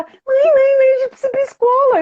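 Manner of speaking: put-on high, rushed, whining little girl; whiny child. Little daughter whining to mother about being late. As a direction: tug at mum to hurry off to school.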